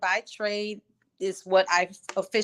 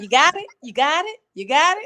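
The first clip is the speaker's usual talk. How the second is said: son's quick check; 7 semitones higher, 7 dB louder than usual. Retold or imitated. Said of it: "He was like".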